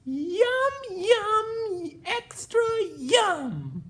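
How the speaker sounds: high, loud delighted yums; excited yum. Acted. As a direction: savor it gleefully. Pea loving spinach.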